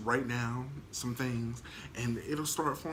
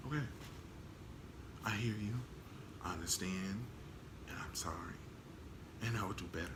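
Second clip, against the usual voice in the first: soft apology; 4 semitones lower, 12 dB quieter than usual. Retold or imitated.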